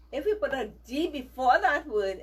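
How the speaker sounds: usual speaking voice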